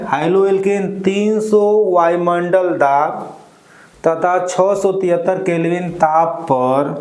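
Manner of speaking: usual speaking voice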